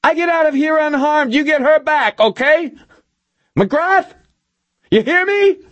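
high, desperate screamed demands; frantic high-pitched bargaining; Milosevic screaming. A narrator's reading. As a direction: scream terms through a door. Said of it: "Milosevic screamed through the door".

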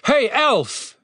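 high-pitched hail; shout. A narrator's reading. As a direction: call out roughly to get attention. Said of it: "he shouted".

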